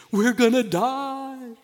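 high, slow mock terror; mock panic. Fishermen in a storm, mock panicked wail. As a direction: whine it like a frightened fisherman.

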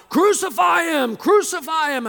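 high, full shout of an angry mob; crying out. Acted. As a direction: yell it as a crowd demanding death. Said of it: "they cried out".

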